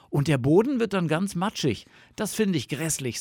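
high-voiced fox grumble; complaining fox. A narrator's reading. Fox objects to spring mud, complaining.

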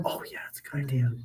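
quick agreeing whisper; leaning in to whisper. Acted. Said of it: "We're sorry for whisper".

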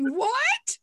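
high squeaky shocked what; shocked kid. Retold or imitated. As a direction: mimic a stunned child. Kids reacting in shock.

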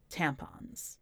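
ordinary delivery

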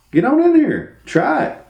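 high, lively egging-on; playful high-pitched encouragement; encouraging dad. Retold or imitated. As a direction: cheer a kid on in a high lilt. Dad urging kid to try.

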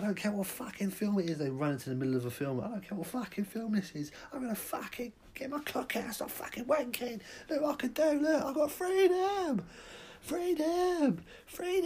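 very high, rising manic shriek; crazed freedom yell. Acted. Cinema maniac shrieking at the audience about freedom. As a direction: scream freedom like a lunatic.

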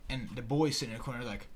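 usual speaking voice

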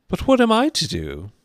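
put-on high, loud girl's wail; frustrated, high-pitched question; exasperated Alice. A narrator's reading. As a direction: plead loudly in a high Alice voice.

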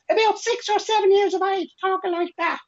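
put-on very high squeaky child voice; his childhood self, pitched right up; chipmunk on helium. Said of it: "Like a chipmunk on helium".

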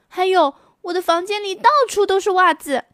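put-on high, fast little girl voice; a child hurrying her complaint; complaining girl.

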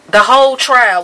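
loud burst of outrage; outraged outburst. Real, not acted. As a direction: blast it out in disbelief. Angry rant about the trial.

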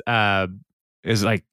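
ordinary delivery

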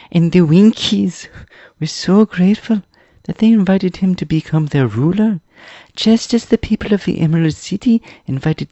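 put-on very high, loud boyish storyteller; eager boy Tip recounting history. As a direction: tell it high and emphatically. Story figure Tip explains.

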